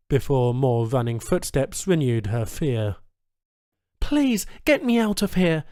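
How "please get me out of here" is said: pleading. Acted. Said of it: "she pleaded".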